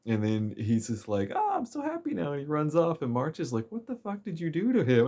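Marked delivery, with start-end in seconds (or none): 1.4-2.3 high, dreamy mock-happy voice; dazed bliss. Brainwashed guy announces his joy, high dreamy voice. 3.7-5.1 high, startled outburst, hammed-up; shocked March. March demands what the player did to the guy, high shocked voice.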